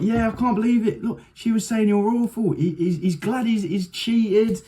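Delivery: high, mock-conspiratorial host; gossiping host. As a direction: egg them on like a gleeful stirrer. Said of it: "and it's like".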